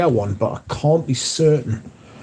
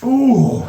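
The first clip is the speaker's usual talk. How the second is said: high ooh of effort; 12 semitones higher than usual. Real, not acted. Exclaims lifting something.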